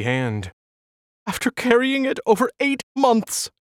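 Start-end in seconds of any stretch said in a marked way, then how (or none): 1.3-3.5 high, tearful plea; pleading. A narrator's reading. Alice pleading with her doctor, high tearful plea. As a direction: beg him, voice pitched up with grief. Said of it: "she pleaded".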